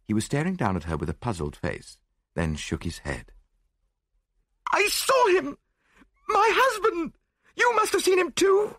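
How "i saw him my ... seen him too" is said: high, loud hysterical insistence; frantic terror. A narrator's reading. Widow begs detective to believe she saw her husband, frantic. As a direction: insist wildly, voice pitched way up.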